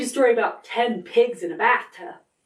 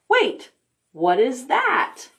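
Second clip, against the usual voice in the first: surprised; 3 semitones higher than usual. Acted. Spotting something in story.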